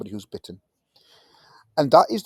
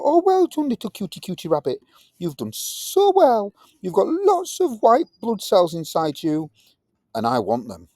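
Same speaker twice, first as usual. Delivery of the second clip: doting praise; 7 semitones higher, 4 dB louder than usual. Acted. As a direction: coo over a clever pet. Praising the rabbit.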